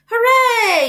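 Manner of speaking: the crowd cheering the pig; cheering yell. A narrator's reading. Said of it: "yelled the crowd".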